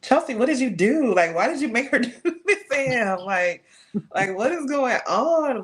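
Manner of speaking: mock dismay. Author scolding herself over her own book, high mock dismay. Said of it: "I was like".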